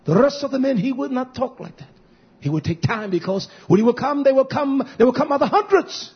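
high, loud preaching climax; fiery preaching. Acted. Pastor preaching to the congregation, fiery and loud.

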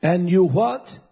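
loud, high, slow preacher's cry; preaching at full force. Acted. Preacher crying scripture out to the church, full force. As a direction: preach it out to the congregation with fervor.

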